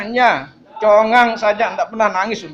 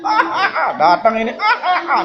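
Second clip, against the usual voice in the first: mock laughing; 9 semitones higher than usual. Mimicking laughter in sermon.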